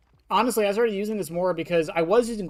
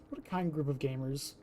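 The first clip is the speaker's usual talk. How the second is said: soft, lowered repeat of the remark; low aside; 4 semitones lower, 10 dB quieter than usual. Pretend. Streamer repeating the remark to self, low aside. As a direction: drop it low and quiet.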